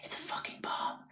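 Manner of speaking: breathy panicked whisper. Retold or imitated. Diners whispering about a bomb, in a hushed whisper. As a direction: hiss it under your breath like a secret. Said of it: "whispering, they're like".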